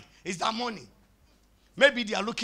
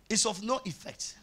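normal talking voice

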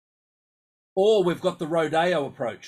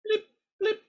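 radar blips. Shown as a demonstration. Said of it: "imitating radar".

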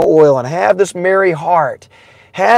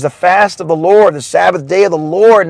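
ordinary talk, nothing marked

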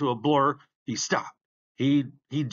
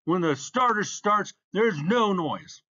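higher-pitched irritated scolding; snarled; 8 semitones higher, 4 dB louder than usual. A narrator's reading. Starter silencing the crowd, mild snarl. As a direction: snap at the noisy crowd, slowing at the end. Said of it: "And Bump turned and snarled".

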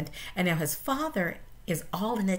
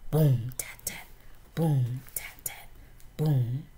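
fading vocal drumbeat; 6 semitones lower than usual. Acted. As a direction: let the beat fade away. Drum rhythm fades.

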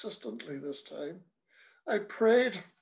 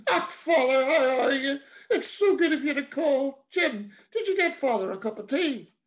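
high, loud gushing welcome; wife delighted to see the priest; 7 semitones higher, 5 dB louder than usual. Acted. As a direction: fuss over the priest. Wife greets priest brightly.

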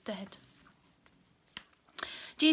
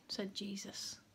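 usual speaking voice

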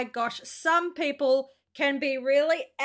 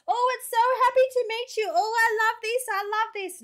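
much higher, fast, overexcited squeal; hammed-up overeager stranger at a handshake; mock gushing greeter; 8 semitones higher, much faster than usual. Australian vlogger mocking over-happy people meeting her, high and fast. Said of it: "we're not gonna say".

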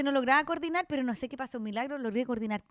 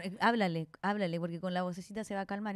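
lower mock staff voice; 7 semitones lower, 6 dB quieter than usual. Staff telling the mother to talk to her baby, lower coaxing tone.

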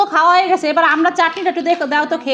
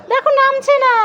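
put-on high, complaining little-kid voice; upset child figure; 10 semitones higher than usual. Toy child complaining that Tommy climbed the tree, in a high voice. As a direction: whine it high like a small child telling on a pet.

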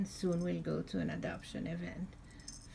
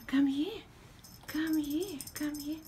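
calling a pet; 9 semitones higher than usual. Real, not acted. Calling the cat over.

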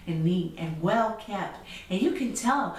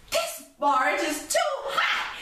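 fussy Goldilocks; 10 semitones higher than usual. Acted. Goldilocks tastes hot porridge.

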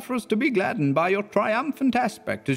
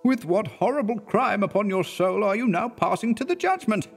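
frightened exclamation; 11 semitones higher than usual. A narrator's reading. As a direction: recoil in horror as the minister. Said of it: "exclaimed the affrighted minister".

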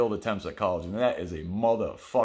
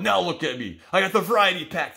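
much higher and louder, showing off; gloating brag; 8 semitones higher, 5 dB louder than usual. Retold or imitated. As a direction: rub it in their faces. Gloating over honey bun haul.

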